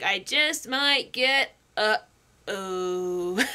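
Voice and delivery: slow, drawn out to a low "oh"; dawning realization. Acted. Quoting the boy as it dawns on him, slowly dawning dread. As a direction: let it sink in word by word.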